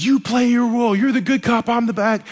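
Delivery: high, loud pushy bad cop; hammed-up bad cop. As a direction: bark out the roles like a tough cop. Jim insists on roles, joking.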